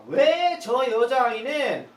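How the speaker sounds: 삼촌이 놀라서 외치는 흉내; mock outraged exclaim. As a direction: blurt it out in disbelief. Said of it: "이렇게 얘기하는 거죠".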